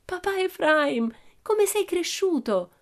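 high, drawn-out exclamation of delight; thrilled daughter's greeting; joyful cry. A narrator's reading. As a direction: gush over papa, slow and very high. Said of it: "gridò Pippi lì appesa al suo collo".

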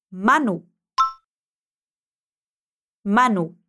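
Manu, stress the MA and let the pitch fall; MA strong, nu light in Manu. Shown as a demonstration. Teacher repeats for the student to copy, MA stressed. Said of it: "A parte forte é o ma".

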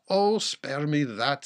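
loud, slow, weary protest; exasperated interjection. A narrator's reading. Earl's daughter stops the man repeating himself, exasperated. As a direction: cut across him loudly, drawing it out. Daughter cuts off his saying again.

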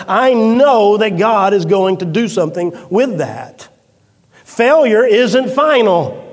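fervent sermon peak; preaching at full force. Acted. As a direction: build it like a pulpit crescendo. Preacher building to a climax.

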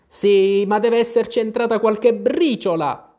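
loud, high-pitched grumble; grumbling. A narrator's reading. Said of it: "borbottò il cappellaio". (brontolio di protesta)

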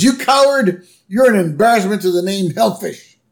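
high-pitched acted outrage; mock indignation. Bart berates the villain in retold scene.

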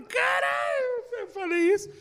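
high-pitched mock cry, first word drawn out; shocked exclamation. Comedian quoting himself after a risky joke, shocked. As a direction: act out shocked disbelief at your own words, high. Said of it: "Mas eu falo".

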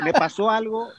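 usual speaking voice

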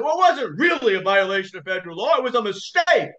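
lightly voiced high, defensive mock judge. Judge excusing the violation to the lawyer, a hint of high defensiveness. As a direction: brush it off like a cornered judge. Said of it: "he was shouting at me".

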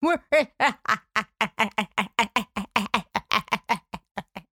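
long staccato mock-villain laugh; rapid-fire fake evil laughter; manic laughing. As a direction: imitate a maniac's cackle, trailing on and on. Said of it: "and then manic laughing again".

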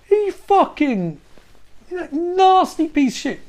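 slow, high-pitched indignation; scandalised rant about Dracula; disgusted outburst. Retold or imitated.

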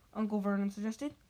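ordinary delivery

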